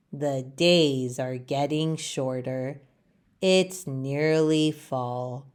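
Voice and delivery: a worried leaf thinking aloud, low and soft; small worried leaf. Acted. Leaf talks to himself, low and soft. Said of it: "Leaf says to himself".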